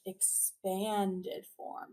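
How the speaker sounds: Expanded form drawn out very slowly and softly, like opening hands; stretched-out demo. Shown as a demonstration. Teacher showing the class how to stretch it out slowly. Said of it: "Make your hands like a jellyfish".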